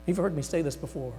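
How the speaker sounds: usual speaking voice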